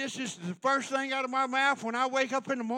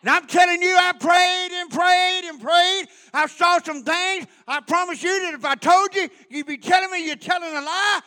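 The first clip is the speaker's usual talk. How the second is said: loud, high-pitched preacher's delivery; full-force preaching; 5 semitones higher, 9 dB louder than usual. Real, not acted. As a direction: preach it out hard, insisting they believe you.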